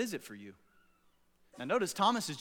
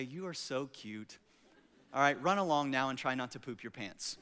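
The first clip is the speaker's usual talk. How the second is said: patronizing coo; 4 semitones lower, 5 dB quieter than usual. Pretend. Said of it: "you're gonna say".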